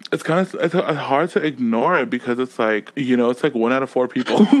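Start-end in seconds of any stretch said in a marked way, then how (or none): none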